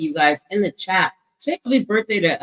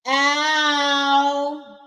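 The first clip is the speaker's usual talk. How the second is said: high hype whoop "Ow"; ow shout; 7 semitones higher than usual. Shown as a demonstration. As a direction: belt out "Ow" like a hype cry. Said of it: "but say, "Ow".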